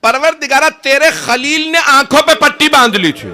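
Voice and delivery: high, loud wailing cry to God; loud weeping cry. A narrator's reading. Husain calling out to God over his son, wailing loud. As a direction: cry it out to heaven, voice breaking high. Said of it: "بلند آواز سے گریہ کر کے کہا".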